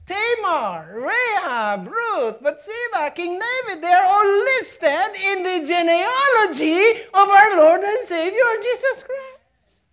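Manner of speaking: high, ringing roll call building to a loud proclamation; full-force preaching. Real, not acted.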